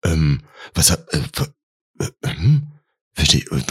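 broken, stuttering confusion; acted flustered false starts; baffled stammer. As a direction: stammer in fragments, trying to gather your thoughts. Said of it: "sich eben mal ganz kurz sortieren müssen".

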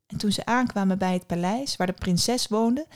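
ordinary delivery, nothing marked

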